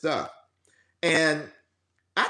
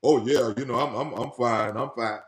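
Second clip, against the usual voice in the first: fake fine; 3 semitones lower than usual. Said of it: "where you lie and you say".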